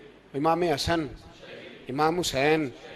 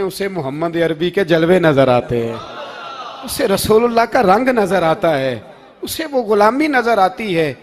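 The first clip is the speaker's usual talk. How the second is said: loud, surging sermon delivery; impassioned preaching; 7 dB louder than usual. Acted. Preacher exalting Umar's devotion to the congregation, impassioned and loud. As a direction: preach it out to the crowd at full force. Preacher rises to full force.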